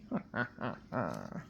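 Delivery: low sinister chuckle; ominous chuckle. A narrator's reading. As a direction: chuckle darkly, under the breath. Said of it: "chuckled ominously".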